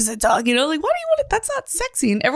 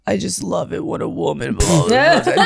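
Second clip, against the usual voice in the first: lower, put-on seductive man voice; mock deep sexy voice; 5 semitones lower than usual. Mocking guys who fake a deep voice, mock sexy and low. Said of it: "try to sound sexy and have a deep voice".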